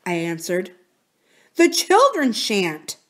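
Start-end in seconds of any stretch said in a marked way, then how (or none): 1.6-3.0 slow, high, emphatic shan't; emphatic shan't, slow and higher. Acted. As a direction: press hard on shan't, slowly. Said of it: "she emphatically".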